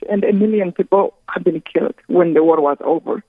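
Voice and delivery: ordinary talk, nothing marked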